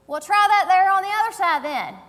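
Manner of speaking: high, loud country holler; country twang. Acted. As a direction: call it out big in a country twang. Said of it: "says in country twang".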